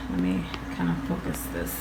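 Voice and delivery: low, soft murmur while fiddling; under-the-breath aside while adjusting the camera. Real, not acted. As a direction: drop low and quiet, half to yourself.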